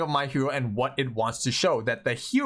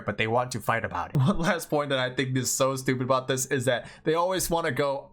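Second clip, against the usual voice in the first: ordinary delivery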